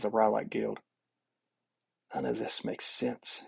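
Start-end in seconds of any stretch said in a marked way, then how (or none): 2.1-3.4 soft, slow inner voice; thinking to himself. A narrator's reading. Senator pondering the Rylak Guild, soft and slow to himself. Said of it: "he thinks to himself".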